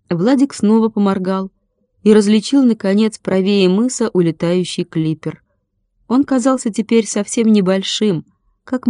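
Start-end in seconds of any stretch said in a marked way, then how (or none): none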